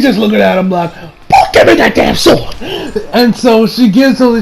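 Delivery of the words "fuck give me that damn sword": high, strained mock yell; hammed-up exasperated character; exasperated demand. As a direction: snap it out like an urgent order. Acts exasperated Alice.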